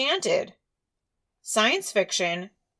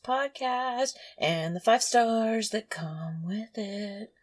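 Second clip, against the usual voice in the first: dreamy announcer; 4 semitones higher, 3 dB quieter, slower than usual. Acted. Podcast intro, dreamy slow.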